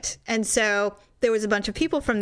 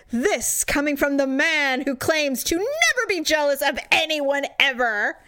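higher, emphatic mock accusation; mock indignant sarcasm; 5 semitones higher than usual. Throwing the boyfriend's claim back at him, mock indignant. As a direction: declaim his boast back at him.

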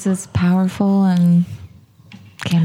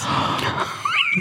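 shocked gasp; 3 dB quieter than usual. Real, not acted. Shocked at the saying.

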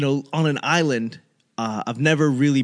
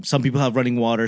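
ordinary talk, nothing marked

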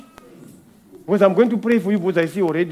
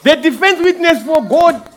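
fiery preaching; 3 semitones higher, 4 dB louder than usual. Acted. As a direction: preach it like calling witnesses to the stand. Preaching at full force.

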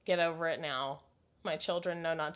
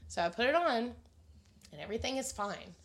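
ordinary talk, nothing marked

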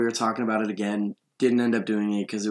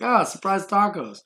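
lightly imitated cartoon delight; higher-pitched, happy little exclamation; Mabel; 7 semitones higher than usual. Cartoon girl finding tacos in the back seat, mock delight. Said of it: "there was a scene where Mabel".

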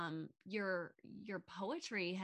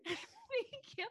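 laughing. Real, not acted. Laughing thanks.

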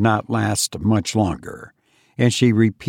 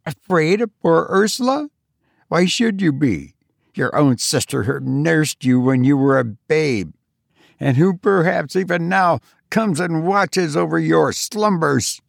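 higher, mocking grim taunt; grim sister, voiced higher; 6 semitones higher than usual. A narrator's reading. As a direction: needle her frightened sister. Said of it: "said her sister grimly".

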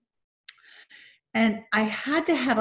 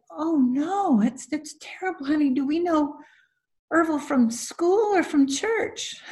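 concerned husband; 7 semitones higher than usual. Retold or imitated. Husband consoling, voiced in story.

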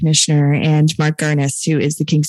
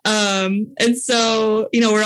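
real laugh running into the words, higher voice; laughing while talking; 4 semitones higher than usual. Real, not acted. Storyteller on being the only woman among the men, laughing. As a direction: talk through the tail end of a laugh.

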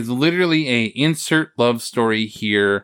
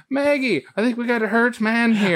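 mock Irish brogue pitched higher; bad Irish accent; 8 semitones higher than usual. Mocking a film's bad Irish accent for his cohost, higher mock brogue. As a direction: ham up a terrible movie Irish accent. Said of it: "you know it's a bad accent when you're like".

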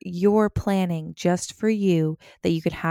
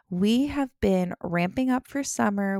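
ordinary talk

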